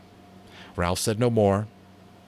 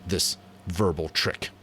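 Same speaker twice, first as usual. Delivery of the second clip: ordinary delivery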